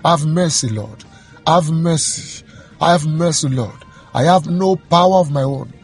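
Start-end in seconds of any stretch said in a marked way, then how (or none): none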